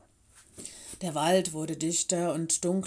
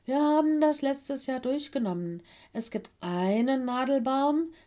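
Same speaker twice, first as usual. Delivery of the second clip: higher, eager recollection; sudden realization; 6 semitones higher than usual. A narrator's reading. Lenny recalling last year's lesson for the friends, eagerly. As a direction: explain it eagerly, pitched up. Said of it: "sagte er plötzlich".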